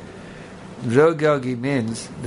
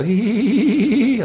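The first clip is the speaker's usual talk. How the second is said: high, loud hee-hee bird call imitation; kookaburra call; 11 semitones higher, 3 dB louder than usual. Shown as a demonstration. Showing the audience how a kookaburra sounds, high cackling hee-hee. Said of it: "I don't hear any kookaburra sound".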